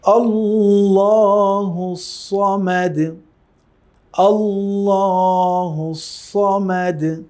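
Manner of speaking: slow melodic tilawat, Samad with a heavy সদ; Quran recitation. Shown as a demonstration. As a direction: chant আল্লাহু Samad drawn out. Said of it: "আমরা আবার practice করছি".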